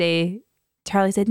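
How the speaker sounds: ordinary talk, nothing marked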